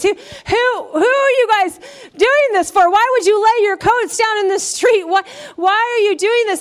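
half-laughing, incredulous townsfolk shouting questions; puzzled crowd. Acted. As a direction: play the baffled crowd asking why. Voicing the puzzled Jerusalem crowd.

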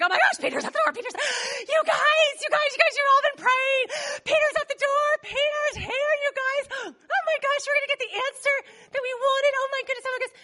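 high-pitched giddy gushing; so excited. Acted. Rhoda runs back to tell the praying group, excited. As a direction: squeal it out, tumbling over repeats. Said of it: "She gets so excited".

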